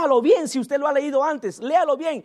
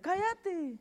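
higher soft put-on voice; cállate; 5 semitones higher, 12 dB quieter than usual. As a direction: shush him quietly and high. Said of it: "le decía yo". ("cállate" agudo y suave)

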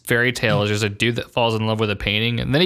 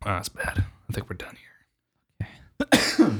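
whisper; 5 semitones lower, 6 dB quieter, much slower than usual. Real, not acted. Giving up on bad meme.

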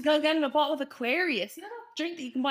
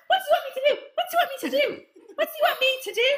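much higher, louder, laughing mock exasperation; exasperated mum; 14 semitones higher, 4 dB louder, much faster than usual. Mum asked to check on crying student, mock exasperated. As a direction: throw up your hands like a fed-up mum.

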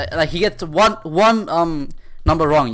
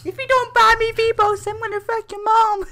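put-on little kid voice. Mocking the kid prank caller to his friend, squeaky kid voice. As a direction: squeak it like a five-year-old. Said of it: "like a five-year-old kid".

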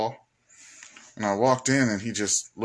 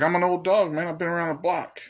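put-on weary old dog; 7 semitones higher than usual. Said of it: "It's like".

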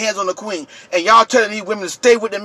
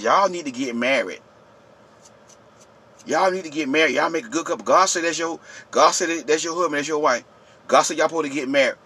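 low, hushed meddler's voice; mock church matchmaker; 6 semitones lower, 7 dB quieter than usual. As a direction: drop low and soft, imitating busybodies pairing people off. Acts out pushy church matchmakers.

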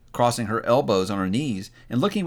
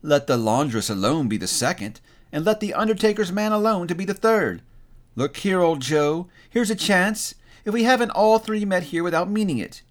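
higher-pitched woman's voice, lightly acted; brash charwoman; 8 semitones higher than usual. First woman greets old Joe at his shop, brash. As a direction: hail an old acquaintance in a higher voice.

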